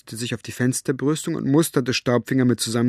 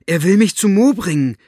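put-on high, loud child's announcement; calling out; 8 semitones higher, 7 dB louder than usual. A narrator's reading. Maggie tells Elinor of Staubfinger's offer, calling out. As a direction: shout it eagerly across the lawn. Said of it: "rief sie".

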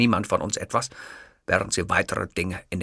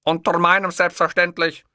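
higher, imperious dictator voice; Hitler voice; 9 semitones higher, 6 dB louder than usual. Acted. Hitler insisting to the hotel clerk, imperious. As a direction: speak as the offended Führer, lofty.

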